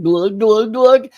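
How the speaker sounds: cartoon guzzling noise; gulping imitation. Shown as a demonstration. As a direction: imitate chugging a drink. Imitating gulping down a slushy drink.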